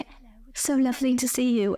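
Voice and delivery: mock a London girl's British accent; fake British accent. Imagining coming back from London, greeting friends in a fake British accent. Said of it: "your fake accent".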